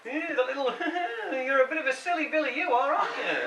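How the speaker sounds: faux-sweet chiding; overly diplomatic. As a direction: patronise her sweetly. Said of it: "very diplomatic about it".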